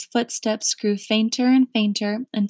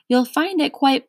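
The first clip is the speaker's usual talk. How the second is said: chuckling; 6 semitones higher, 4 dB louder than usual. A narrator's reading. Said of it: "chuckled the policeman".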